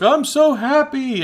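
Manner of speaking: high, slow, mock-weepy happy wail; crying girl. Girl sobbing with joy over new cat, high mock wail. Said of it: "And they're like".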